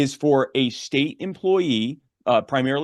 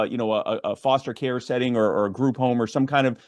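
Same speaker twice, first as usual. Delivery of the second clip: usual speaking voice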